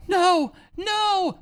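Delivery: high-pitched mock scream at normal volume; imitated screaming woman. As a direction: imitate a woman crying out in distress. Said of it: "your impression of the woman screaming".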